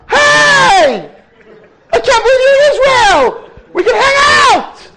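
put-on a girl thrilled to spot him; squealing girlfriend. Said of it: "She's like".

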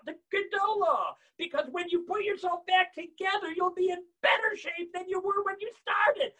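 high, full-force shouted exhortation; rousing preaching. Real, not acted. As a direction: shout it out as the sermon's climax. Preaching climax about final great blast.

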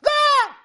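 sharp, very high, loud shriek of fright; a sudden startled scream. Acted. Chaim spotting feet in the garbage, screaming. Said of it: "he let out a scream".

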